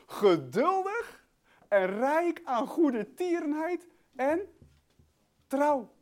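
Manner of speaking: high, slow, drawn-out declaiming; relished proclamation. Real, not acted. Preacher reading out God's greatness, relished proclamation. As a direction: build each attribute up, slowly and grandly.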